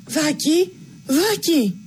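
high, panicked calls of a name; a mother calling her lost son in fright; frantic mother calling. A narrator's reading. Mother searching the woods, calling out in panic. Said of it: "ακούστηκε αλαφιασμένη φωνή της μάνας".

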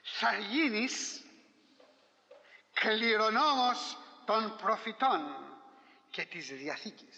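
slow, solemn sermon cadence; preaching. Acted. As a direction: lay out each promise slowly. Preacher lists God's gifts. (αργό, επίσημο κήρυγμα)

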